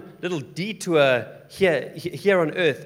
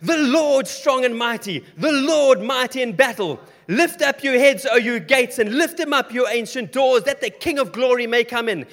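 triumphant shout; 7 semitones higher, 7 dB louder than usual. Acted. Saints answering the angel guards in a triumphant shout. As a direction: shout it out in victory. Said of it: "in unison they shout".

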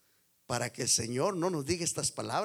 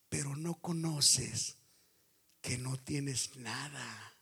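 slow, much softer reply; God's gentle rebuke; 2 semitones lower, 5 dB quieter, slower than usual. Retold or imitated. God answering the boastful church, slow soft rebuke. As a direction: lay the truth down gently and slowly. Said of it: "Pero Dios le dice".

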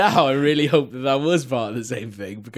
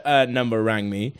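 normal talking voice

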